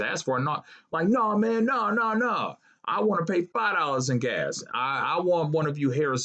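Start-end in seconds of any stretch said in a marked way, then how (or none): none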